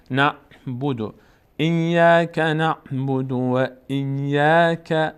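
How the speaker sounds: very slow, sustained tajweed chant; Quran recitation. Shown as a demonstration. Teacher breaks the verse into pieces, slow recitation. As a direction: chant each piece slowly for learners to follow.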